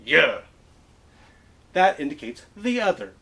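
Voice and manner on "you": you said as a loud Yeah; Western you. Shown as a demonstration. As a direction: punch it out as Yeah. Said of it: "Try it with me now".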